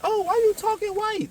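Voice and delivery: high-pitched mock taunt; mocking onlooker. People calling out someone's proper speech, high mocking voice. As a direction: pitch it way up, imitating a teasing critic.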